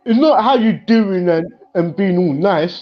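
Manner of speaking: ordinary talk, nothing marked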